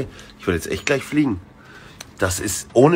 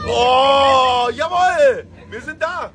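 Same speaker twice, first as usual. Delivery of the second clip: high triumphant cheer; excited cheering; 13 semitones higher, much slower than usual. Real, not acted. Landing succeeded.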